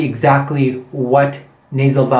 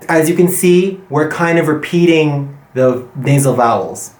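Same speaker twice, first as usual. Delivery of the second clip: ordinary talk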